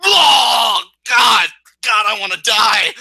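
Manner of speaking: loud and high, overcome; anguished fan shout. Real, not acted. Host overcome by his favourite wrestler, shouting. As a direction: cries out as if dying of love. Overwhelmed by love for Kairi.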